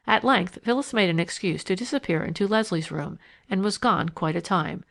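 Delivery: plain reading voice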